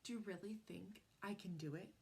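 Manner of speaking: hushed, soft and wondering; a soft, hopeful question whispered in the dark. A narrator's reading. Sisters whispering in bed at night, hushed. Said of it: "they whispered into the night".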